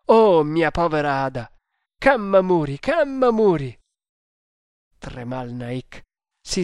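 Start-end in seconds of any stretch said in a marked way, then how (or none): 0.0-3.8 loud, anguished calling; distraught, full-voiced lament; heart-rending cry. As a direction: wail for help, climbing in pitch. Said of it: "esclamò con accento straziante".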